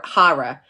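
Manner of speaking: "horror" said as "HAH-ruh"; New York horror. Shown as a demonstration. Teacher showing how New Yorkers say it, "HAH-ruh" pronunciation. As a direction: open "ah" in "horror". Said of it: "New York say hor".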